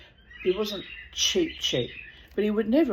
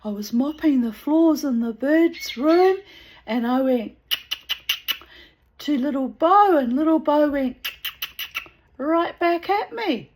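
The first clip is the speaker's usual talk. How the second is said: higher, louder retelling of an excited friend; excited, pitched-up quote; excited and happy; 9 semitones higher, 8 dB louder than usual. Retold or imitated. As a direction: imitate someone bursting with happy news. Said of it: "she was all excited and happy".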